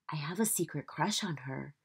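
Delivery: secret whisper; whispering a shy secret. Acted. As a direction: breathe it like a confession. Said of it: "Jojo whispered".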